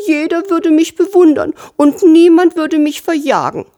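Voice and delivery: high, squeaky little sparrow voice; cartoon bird. A narrator's reading. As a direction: chirp it like a tiny wistful bird. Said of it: "piepste der kleine Spatz". (hoch piepsende Spatzenstimme)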